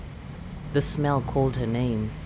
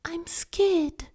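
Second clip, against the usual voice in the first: put-on very high frightened little girl whisper; scared child whisper. Girl whispering her fear to grandmother. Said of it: "she said".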